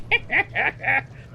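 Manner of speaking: high, stuttering little snicker; snicker under breath. Acted. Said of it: "he laughs under his breath a little bit".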